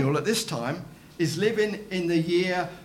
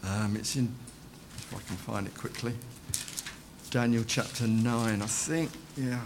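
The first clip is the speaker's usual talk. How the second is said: muttered aside; 10 semitones lower, 11 dB quieter than usual. Real, not acted. Aside while searching Bible.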